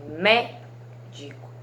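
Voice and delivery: slow "médico" broken up, strong "mé"; stress on first syllable. Shown as a demonstration. Teacher repeating for pupils, "médico" split with "mé" stressed. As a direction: draw out "mé" in "médico", then drop the ending. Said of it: "é a sílaba mais forte".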